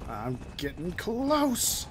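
slow, hammed-up call with "close" held long; drawn-out mock yell. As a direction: stretch "close" out for comic effect. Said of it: "That's what I yell".

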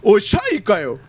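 ordinary talk

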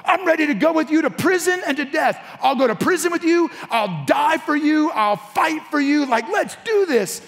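high-pitched, eager vow of loyalty; Peter. Acted. Disciple pledging to follow his Lord to death, gung-ho. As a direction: declare it high and full of bravado. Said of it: "Peter speaks up".

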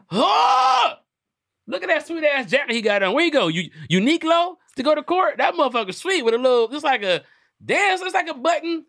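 high, loud, laughing mockery of the jacket; excited roast. Real, not acted. Roasting the killer's court outfit, high and loud. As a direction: crank up the pitch and clown on him.

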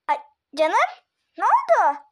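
loud, high mock alarm; feigned concern. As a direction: pretend to be shocked for her. Prankster feigns surprise at victim. (yapmacık, yüksek sesli telaş)

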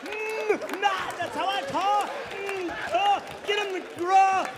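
very high angry stutter; stuttering driver. Acted. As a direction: stammer defensively. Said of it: "if you had a stutter".

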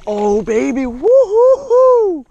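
high excited cry building to long loud whoops; elated whooping. Real, not acted. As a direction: whoop it out, drawn out and soaring. Celebrates landing a good fish.

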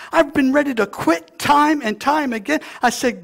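loud, high, fervent preacher climb; soaring loud pulpit cry; full-force preaching. Real, not acted. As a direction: preach it out to the whole church.

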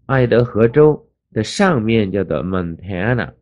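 normal talking voice

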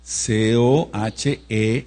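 letters spelled out slowly; spelling it out. Shown as a demonstration. Teacher spelling Cohenet for the class, slow and deliberate. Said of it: "acento en esa E".

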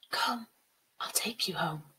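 soft, breath-filled, soothing invitation; tender near-whisper. Acted. Water spirit taking the girl home, soft whispery reassurance. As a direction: coax her kindly in a hush.

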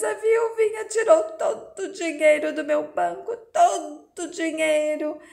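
broken, sobbing lament. A narrator's reading. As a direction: wail through tears over lost money. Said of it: "o conde senta e chora".

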